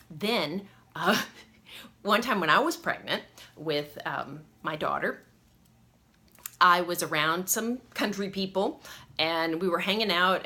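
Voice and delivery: usual speaking voice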